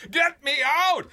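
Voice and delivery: high, loud desperate bawl. A narrator's reading. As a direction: yell as if trapped in a mine. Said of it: "I bawled".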